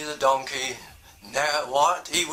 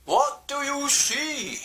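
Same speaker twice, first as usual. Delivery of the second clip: owl asking; 10 semitones higher, slower than usual. Acted. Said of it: "and Doctor Owl said".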